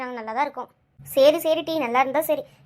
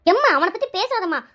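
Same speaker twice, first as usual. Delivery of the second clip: scolding daughter; 4 semitones higher, 6 dB louder than usual. Acted. Daughter scolds mother about brothers.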